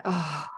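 long, soft exhale; low breath out with a shrug; shrugging sigh. Retold or imitated. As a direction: let out a resigned, acted sigh. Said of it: "take a deep breath in and kind of shrug like".

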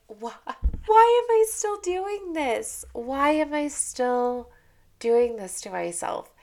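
slow high exasperated self-questioning; exasperated whine. Retold or imitated. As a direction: whine at yourself in frustration. Frustrated retold self-talk.

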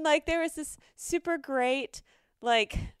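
normal talking voice

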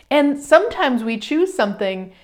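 usual speaking voice

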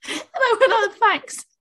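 high, loud laughing reply; crying with laughter. Real, not acted. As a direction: laugh through the words.

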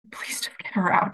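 urgent retold whisper; hissed plea under the breath. Begging whisper to mom mid-labor.

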